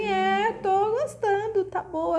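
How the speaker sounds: high, slow mock-pleased king voice; king savoring food. King answering the queen at dinner, high and hammed-up. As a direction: ham up the contented king chewing his dinner.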